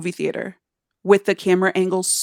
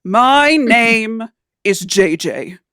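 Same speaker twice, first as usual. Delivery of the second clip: slow, grand mid-Atlantic actor voice; transatlantic Shakespearean accent; 6 dB louder, slower than usual. Retold or imitated. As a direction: draw it out like a stage Shakespearean. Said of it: "weird transatlantic Shakespearean accent".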